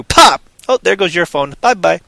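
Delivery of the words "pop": high, loud comic pop. Acted. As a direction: smack the word out like a hit. Whacking phone users in theater.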